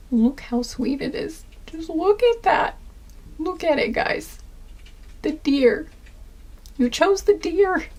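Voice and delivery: high-pitched adoring coo over something sweet; gushing. Real, not acted. Gushing to viewers over the deer card they chose, adoring high coo. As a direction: coo in delight, pitched well up.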